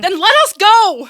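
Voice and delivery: loud, high demand; angry outburst. Acted. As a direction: shout it out in desperation. Demanding freedom from the boss.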